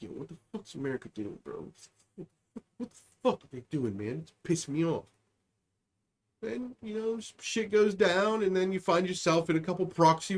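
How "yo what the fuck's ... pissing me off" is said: hushed, fast, much softer backroom whisper; whispering gossip. Retold or imitated. Other nations gossiping behind the scenes, fast hushed whisper. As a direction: mutter it secretly behind America's back. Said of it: "there starts to be whispers behind the scenes".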